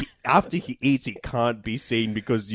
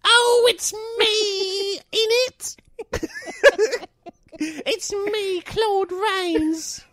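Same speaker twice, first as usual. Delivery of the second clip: high squeaky exaggerated Cockney accent; mock Cockney; much slower than usual. Co-host asks how a Cockney actor sounded, squeaky mock Cockney. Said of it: "What would that sound like?".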